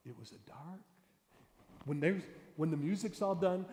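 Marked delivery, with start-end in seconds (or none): 0.1-0.8 hushed storyteller. Shown as a demonstration. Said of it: "What you do is you say".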